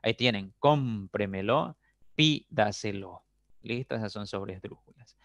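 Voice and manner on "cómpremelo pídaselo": slow "cómpremelo", "pídaselo", stretched on CÓM and PÍ; stress on fourth-last syllable. Shown as a demonstration. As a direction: lean hard on the first syllable of "cómpremelo" and "pídaselo", slowly. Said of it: "Sobreesdrújulas llevan la mayor fuerza de voz".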